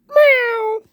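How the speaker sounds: high squeaky meow; cat meow. Pretend. Cat meow in plushie showing.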